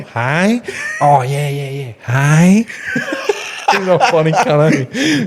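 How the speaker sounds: high, exaggerated Aussie "hey"; imitated Aussie accent. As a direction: mimic a comedian's spot-on Aussie accent, pitched high. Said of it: "he does a spot on accent".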